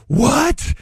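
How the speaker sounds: high mock disbelief; a sudden squeak of outrage at the price; shocked what. Kid learning the candy bar costs fifty cents, shocked squeak. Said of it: "I was like".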